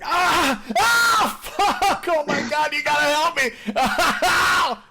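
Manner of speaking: high shrieking yells of pain, dragged out slow; hammed-up agonized shout with a laugh in it; panicked screaming caller. Shown as a demonstration. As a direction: scream like you're hurt and desperate on a 911 call. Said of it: "You gotta be like".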